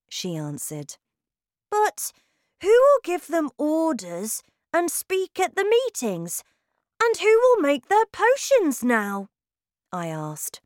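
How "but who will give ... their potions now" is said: put-on high, loud child's voice, full of questions; eager boy. A narrator's reading. Boy asks grandmother about the other witches, eager high voice. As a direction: ask like an eager little boy.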